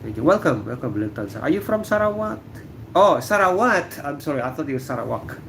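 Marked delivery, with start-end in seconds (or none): none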